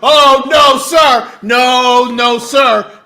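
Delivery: loud, high indignant objection; shouted, scolding refusal; loud protest. Real, not acted. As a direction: shut him down with repeated loud noes. Mock outrage at Rob's soft answer.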